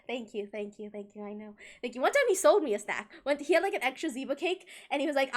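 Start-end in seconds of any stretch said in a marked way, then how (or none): 0.1-2.0 much softer, faux-modest thank-yous; smug thanks. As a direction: accept the praise with mock humility. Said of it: "I was like".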